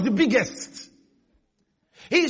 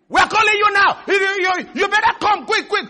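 higher, louder, pressing demand; pushy debt collector; 7 semitones higher, 5 dB louder than usual. Retold or imitated. Preacher acting the creditor calling about the debt, loud and pushy. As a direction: bark it like a bill collector on the phone.

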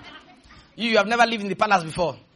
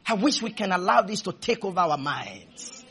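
normal talking voice

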